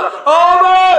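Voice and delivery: high loud call across the mosque. Retold or imitated. One worshipper calling out to another, high and loud. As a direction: yell out for your brother. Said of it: "একজন কয়".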